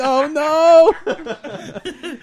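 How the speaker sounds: squeaky high oh no; hammed-up falsetto groan; high mock dismay. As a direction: play it as a tiny mortified squeal. Mock dismay in retold exchange.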